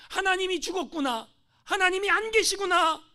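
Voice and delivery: high, loud cry of lost faith; despairing cry. Retold or imitated. Pastor crying out in despair in his cell. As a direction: wail that God is gone.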